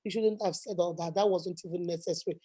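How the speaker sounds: low, hushed scolding of herself; soft inner voice telling herself off; quiet self-scolding. Retold or imitated. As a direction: mutter a reproach to yourself under the breath. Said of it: "I say".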